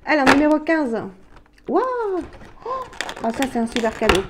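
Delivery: loud, high burst of delight at the gift; thrilled gift reveal; excited unboxing. Real, not acted. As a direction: gush over the present. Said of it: "je suis contente".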